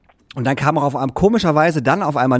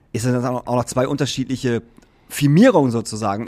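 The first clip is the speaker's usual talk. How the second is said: ordinary talk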